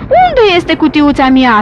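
slow, loud, indignant demand; angry snake character voice; upset snake. A narrator's reading. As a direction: stretch it out in loud annoyance. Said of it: "supărat, scoțându-și capul din galerie".

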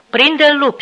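furious that the dog won't obey, yell it; angry repeated shout. Acted. Officer angrily shouting the dog on again, angry yell. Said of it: "strigă el din nou, supărat".

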